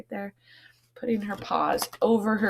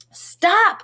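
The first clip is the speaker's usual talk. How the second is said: high, loud call to halt; cry stop; 5 dB louder than usual. Acted. Little mouse crying out to stop the quarrel. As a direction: shout them down. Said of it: "cried the little mouse".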